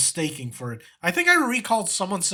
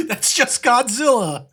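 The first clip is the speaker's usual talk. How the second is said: laughing; 9 semitones higher, 7 dB louder, slower than usual. Real, not acted. Laughing at the Godzilla clip.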